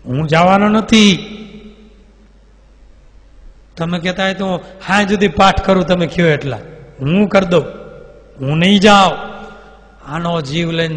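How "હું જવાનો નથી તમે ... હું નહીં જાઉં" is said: defiant demon. Acted. Demon refusing to leave the body, loud and defiant. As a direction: dig in and refuse. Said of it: "બ્રહ્મ રાક્ષસ કે".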